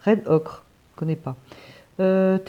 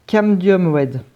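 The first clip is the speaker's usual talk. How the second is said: "Camdium red" read out louder in a clumsy English accent; 9 dB louder, slower than usual. Shown as a demonstration. Reading a paint label to viewers in an English accent. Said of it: "l'accent anglais en plus, c'est pas beau".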